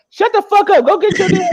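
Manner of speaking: sudden loud high snap after hanging up; angry snap. Retold or imitated. Dad hanging up on the bill collector, loud high snap. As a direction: drop the polite act and bark it. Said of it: "he can hang up and be like".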